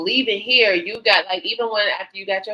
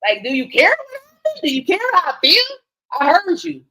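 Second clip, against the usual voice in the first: higher and louder, pressing do you care; insistent demand; 9 semitones higher, 7 dB louder than usual. Retold or imitated. Wife demanding Rob show he cares, heated insistence. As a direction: push him hard for an answer. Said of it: "demanding of Rob".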